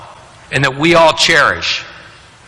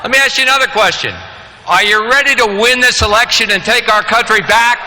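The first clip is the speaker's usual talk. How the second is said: booming motivational speech; 7 semitones higher than usual. Acted. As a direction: whip up the crowd, booming. Rally speaker firing up crowd.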